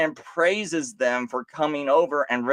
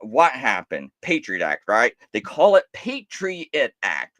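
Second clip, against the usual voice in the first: normal talking voice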